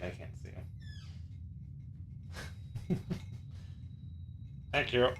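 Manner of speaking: ordinary talk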